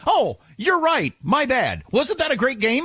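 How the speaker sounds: high, over-agreeable chatbot voice; imitated AI backtracking. Imitates AI backing down.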